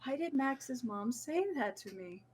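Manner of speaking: hushed, baffled wondering to oneself; puzzled inner voice. Retold or imitated. Friend replaying years of puzzling over a mom's remark, hushed and baffled. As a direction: ask it softly, as a nagging private question. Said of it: "of just like".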